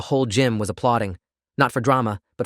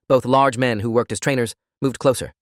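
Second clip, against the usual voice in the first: ordinary delivery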